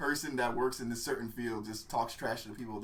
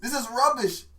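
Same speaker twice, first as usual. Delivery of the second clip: higher-pitched angry British chef rant; mock-angry British scolding; Gordon Ramsay; 6 semitones higher than usual. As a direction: berate them like a British TV chef. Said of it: "he has a British accent and he's, like, so angry".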